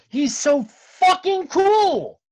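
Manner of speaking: high, loud, drawn-out fangirling; gushing excitement. Real, not acted. Cohost raving about the director's exploit, gushing. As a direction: gush slowly and loudly, pitch soaring.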